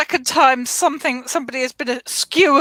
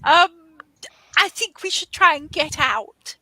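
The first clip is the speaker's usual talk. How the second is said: raised pitch, hesitant and apologetic; awkwardly polite, higher-voiced adventurer; Chard in character; 4 semitones higher than usual. Chard introducing himself to Mister Spinning Man, awkwardly polite. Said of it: "So Chard turns to Ogval and says".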